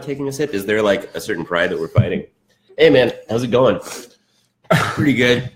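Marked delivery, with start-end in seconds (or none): 2.8-5.5 playing a passerby. Acting out a passerby exchange.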